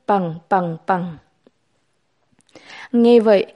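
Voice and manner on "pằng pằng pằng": low, spaced spoken bangs; narrated gunfire. A narrator's reading. Narrator sounding out shots after the order, low bangs. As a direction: drop the pitch for each separate bang. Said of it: "mọi người hoảng sợ nổ súng".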